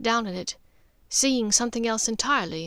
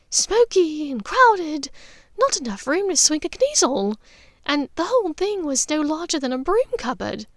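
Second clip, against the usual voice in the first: higher, lightly amused lady's voice; amused Narcissa; 7 semitones higher, 4 dB louder than usual. A narrator's reading. Narcissa telling her cousin about the crowded pub, amused. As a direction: remember the pub fondly, savouring it.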